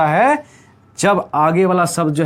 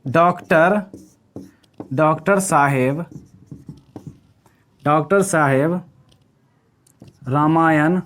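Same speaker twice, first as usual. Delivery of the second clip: slow dictation; 3 semitones lower, slower than usual. Shown as a demonstration. Teacher slowly dictating the memory trick for notes. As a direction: dictate each word of the trick slowly, with long pauses. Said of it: "इसका हमने एक trick बनाया है".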